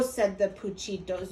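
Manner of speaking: plain reading voice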